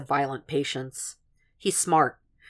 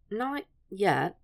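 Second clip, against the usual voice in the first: higher, softer hesitant answer; slow, reluctant; 5 semitones higher, 5 dB quieter than usual. A narrator's reading. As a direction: draw the answer out softly. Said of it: "she said slowly".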